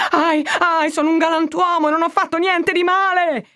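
high, desperate cry to the crowd; shouting for help. A narrator's reading. Prisoner calling to the crowd, high pleading shout. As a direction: call out to the street, begging rescue. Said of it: "alzò la voce e ... gridò".

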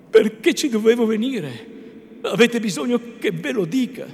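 impassioned lover's reproach; hurt indignation. A narrator's reading. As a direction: throw the questions back, wounded. Renzo indignant at Lucia's question.

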